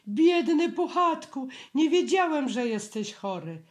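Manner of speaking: a high, anxious little piglet; high and loud, fretting over Pooh. A narrator's reading. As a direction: squeak out your worry for your friend. Piglet voiced by narrator.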